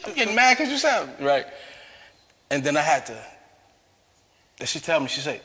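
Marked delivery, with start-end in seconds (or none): 0.1-1.1 high, loud mock outrage; retold mad retort. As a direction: laugh through an exaggerated angry comeback. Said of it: "So I got mad at her".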